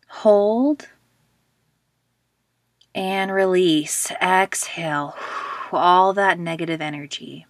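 measured meditation guide with long pauses; guided meditation. Acted. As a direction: lead listeners into relaxation, leaving space after each phrase.

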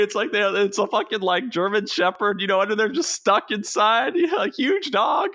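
high Southern storyteller imitation; thick Southern accent. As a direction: mimic a dumb-sounding Southern buddy telling his tale. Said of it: "That's the way he tells the story".

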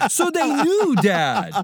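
squeaky cheeky comeback; mock retort.

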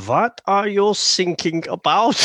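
put-on slow, high, puzzled German accent. German coastguard misunderstands the distress call, thick German accent. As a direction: ask it slowly as a hammed-up German. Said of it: "the German guy's like".

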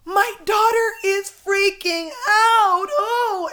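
high, loud, slow wailing excitement; mimicked overwhelmed woman. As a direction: imitate a contestant almost crying as she yells. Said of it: "she's almost crying".

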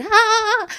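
high loud wobbly scream; shaky scream on a ride. Retold or imitated. Rattled on the wooden coaster, she screams high and loud. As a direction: yell like the ride is rattling you. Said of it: "my voice like".

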